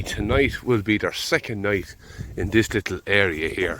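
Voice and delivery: ordinary talk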